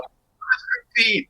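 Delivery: high, drawn-out mock fan squeal, held back in volume; hysterically happy. Hysterical fan in photo with idols, mock squealing. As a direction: imitate a slow, squealed fan cry. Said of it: "'cause I'm so hysterical and happy".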